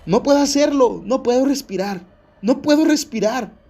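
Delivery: high, loud panicked cry; acted panic, voice pushed up high; retold screaming. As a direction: reenact a trapped child's desperate scream. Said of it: "Candace comienza a gritar".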